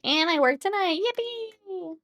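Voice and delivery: high, drawn-out yippee; rising into a high, playful cheer; mock cheer. Streamer facing another night of work, mock cheer.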